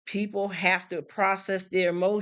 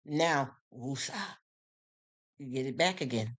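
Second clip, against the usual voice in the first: calming breath; 4 semitones lower, 6 dB quieter than usual. Real, not acted. Calming herself after anger.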